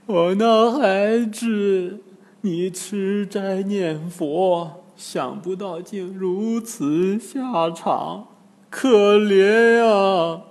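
slow, high, drawn-out acted lament; wailing. Old woman mourns her slain daughter, wailing. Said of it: "突然大哭起来".

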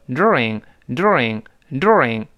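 slow during with plain doo and a curled r, American style. Shown as a demonstration. Teacher reading during the American way. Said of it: "所以美国人是读成during".

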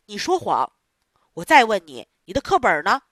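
stern accusation. Acted. Theatre boss scolding Pinocchio for lying, loud and stern. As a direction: snap at the lying puppet.

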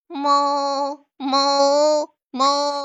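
mooing like a cow; loud drawn-out moo. Acted. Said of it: "学牛叫".

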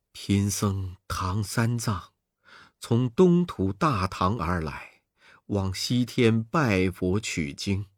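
low, soft, slow monk voice; gentle monk. Acted. Captive monk Tang introducing himself to the demons. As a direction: answer meekly and calmly. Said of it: "唐僧答道".

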